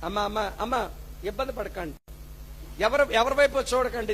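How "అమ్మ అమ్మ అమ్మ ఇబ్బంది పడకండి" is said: much softer, gentle calming; soft reassurance. Real, not acted. Pastor calming an embarrassed congregation, soft and gentle. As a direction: ease their embarrassment gently.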